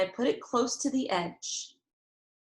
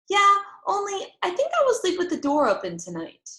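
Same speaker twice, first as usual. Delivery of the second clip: put-on much higher, louder child voice, pushing her point; a kid digging in her heels, pitched up; insistent little girl; 9 semitones higher, 4 dB louder than usual. Junie B. jumping up to tell Mother, much higher and louder.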